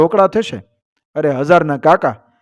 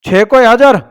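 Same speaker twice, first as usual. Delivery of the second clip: loud, higher call for the guards; king's shout; 6 semitones higher, 5 dB louder than usual. Acted. King calls out loud for his guards. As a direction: bellow it out like a king summoning his men. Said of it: "બાદશાહે બૂમ પાડી".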